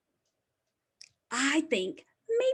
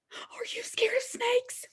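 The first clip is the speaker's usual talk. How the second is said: whisper; 10 semitones higher, 5 dB quieter than usual. Acted. Pretend fear of the snake.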